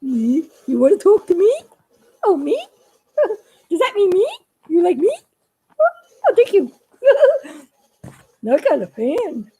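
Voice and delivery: a puppet squealing with joy at a fan. Acted.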